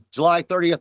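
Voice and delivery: ordinary talk, nothing marked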